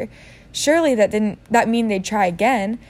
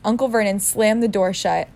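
ordinary delivery

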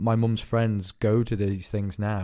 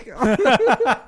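high laugh; 12 semitones higher, 6 dB louder than usual. Real, not acted. Laughing at own impression.

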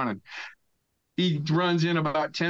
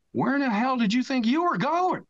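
much higher mock-incredulous question; incredulous boss; 11 semitones higher than usual. Boss teasing the guest who fled, mock-incredulous. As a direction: needle him like an amused boss. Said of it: "the boss goes".

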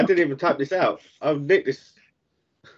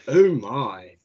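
low, drawn-out catchphrase impression; George Takei; 4 semitones lower than usual. Host answers friend's tease with George Takei catchphrase impression. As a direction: stretch it out low and suggestive. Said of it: "turn it to George Takei".

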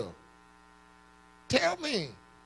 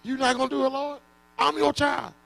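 Paul pleading; 6 semitones higher, 4 dB quieter than usual. Acted. Paul pleading back with God.

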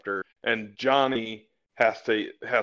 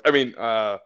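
normal talking voice

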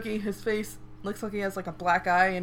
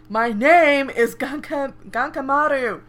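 slow, louder pompous name declaration; grand introduction; 5 semitones higher, 10 dB louder, slower than usual. Retold or imitated. Swordsman introducing himself, slow and pompous. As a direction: announce your name proudly.

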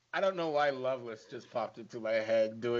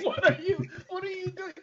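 mock bewilderment; faster than usual. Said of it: "It's just like".

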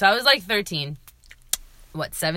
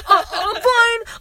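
laughing through it; 13 semitones higher, 4 dB louder, much slower than usual. Retold or imitated. Quoting herself after getting hit.

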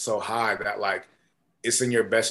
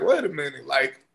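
loud, high-pitched disbelief in a retold reaction; incredulous; 8 semitones higher, 10 dB louder than usual. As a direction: voice leaps up, baffled by the stranger. Sizing up an unknown newcomer, retold.

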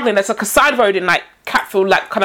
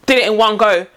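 ordinary delivery

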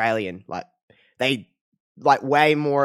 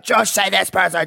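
a higher, louder, pushy fan demand; mock Australian listeners; 6 semitones higher, 4 dB louder than usual. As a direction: call out to Josh like an insistent Australian listener. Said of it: "Australians listening to this will be like".

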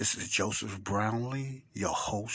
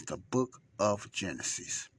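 normal talking voice

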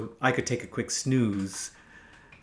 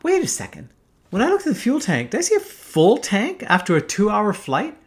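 high-pitched, incredulous inner voice; sudden puzzled realisation; 8 semitones higher than usual. Retold or imitated. Pilot realising the fuel tank is oddly full, incredulous. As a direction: think aloud, pitched up in disbelief.

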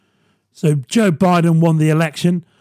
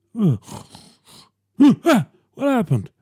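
Biden impression; 4 semitones higher than usual. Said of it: "my Biden impression".

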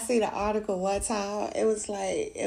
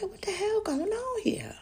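high, whispered mock outrage; mock exasperated mom; 11 semitones higher than usual. Half-awake mom whispering at rowdy kids, mock outrage. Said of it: "I'm like".

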